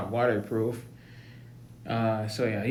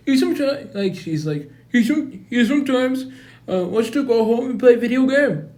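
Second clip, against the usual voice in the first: much higher and louder put-on voice; hammed-up high principal; 11 semitones higher, 8 dB louder than usual. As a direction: play the principal in a squeaky voice.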